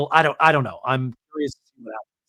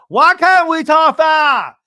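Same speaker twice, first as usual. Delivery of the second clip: high, loud, slow imitation of an outburst; host mimics Lisa exploding at dinner, high, slow and loud; exploding shout; 12 semitones higher, 11 dB louder, slower than usual. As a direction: blow up at the table, drawn out. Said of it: "Lisa explodes and goes".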